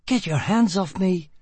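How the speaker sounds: high, furious outburst; snapping in rage. A narrator's reading. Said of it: "she cried furiously".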